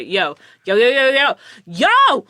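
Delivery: rising call for attention. Shown as a demonstration. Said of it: "it's to say".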